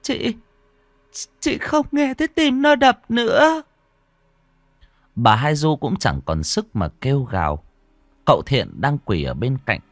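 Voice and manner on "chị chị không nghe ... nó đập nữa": much higher, halting and tearful. Acted. Hoa tells Du her baby's heart stopped, tearful. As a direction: break the news through tears. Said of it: "nước mắt lã chã rơi".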